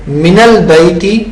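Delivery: من البیتی read minal-bayti, zabar on the nun of من. Shown as a demonstration. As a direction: slowly join من into البیتی with an a sound. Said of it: "من کے نون کے اوپر زبر".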